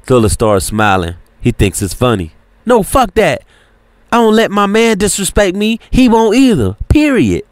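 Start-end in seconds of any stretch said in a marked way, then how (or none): 2.6-7.4 indignant. Acted. Serafina still angry.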